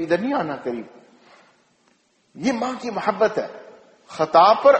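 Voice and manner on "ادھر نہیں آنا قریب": gentle warning. Retold or imitated. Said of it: "اور بیٹے کو کہے گی".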